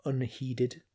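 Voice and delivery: ordinary delivery, nothing marked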